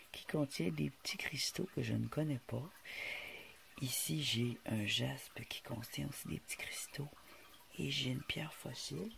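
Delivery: soft whisper, close to mic. Real, not acted. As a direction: breathe the words, voiceless. Whispered description of rocks.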